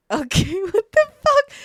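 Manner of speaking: high, loud, laughing disbelief; drawn-out, high-pitched mock shock; startled reaction. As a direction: retell it as a stunned, laughing yelp. Retelling her startled reaction to pistol.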